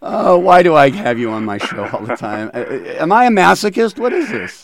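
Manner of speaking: high-pitched, loud playful protest; mock exasperation. Host playfully protests guest's ribbing.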